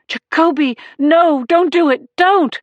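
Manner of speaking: high, loud, rising alarm; urgent shout. A narrator's reading. Sergeant shouting at partner not to tase, urgent shout. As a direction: shout to stop him firing. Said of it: "I shouted".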